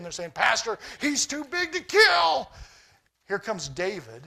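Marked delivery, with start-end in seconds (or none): 0.3-2.5 higher, louder, slow mock panic; scared soldiers. Israelite soldiers telling the pastor the giant is too big, mock fear. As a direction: whine it like frightened troops. And they're shaking.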